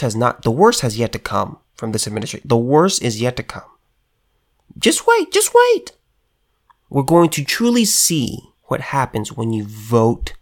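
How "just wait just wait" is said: high, taunting just wait; high teasing warning; mock ominous warning. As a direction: sing it out high like a teasing threat.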